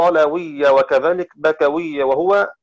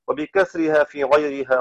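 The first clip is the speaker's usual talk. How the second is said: normal talking voice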